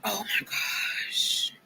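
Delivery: slow, stunned whisper; whispered shock. Real, not acted. Seeing the picture, a slow stunned whisper. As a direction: breathe it out, stunned.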